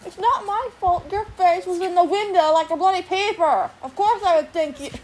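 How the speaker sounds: loud, high exasperated yell; angry yell. Retold or imitated. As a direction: shout it at her in outrage. Yelling at mom at window.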